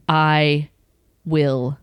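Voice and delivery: low, slow, drawn-out retold threat; seething threat. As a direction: spell out the threat word by word, seething. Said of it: "I was so angry".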